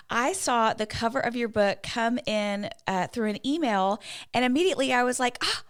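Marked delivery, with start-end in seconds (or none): 5.4-5.6 excited squeal. Retold or imitated. Excited reaction to book cover.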